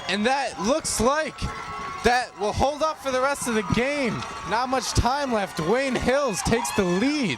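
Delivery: loud, high, drawn-out excitement; excited slow call. Real, not acted. Still shouting after the goal.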